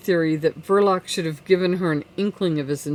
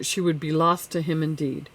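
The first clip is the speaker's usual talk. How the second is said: usual speaking voice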